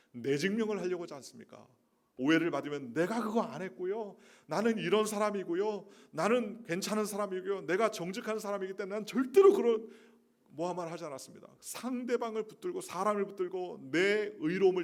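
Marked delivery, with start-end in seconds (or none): 2.9-11.5 higher, louder, indignant self-defense; defensive protest. Retold or imitated. A wronged believer loudly protesting to people, indignant and high. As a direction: insist you did nothing wrong. Acting someone defending themselves.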